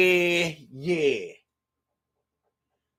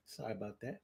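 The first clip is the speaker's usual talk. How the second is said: much softer echo of the apology; hushed repeat; 8 semitones lower, 22 dB quieter, much faster than usual. Shown as a demonstration. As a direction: drop it almost to nothing.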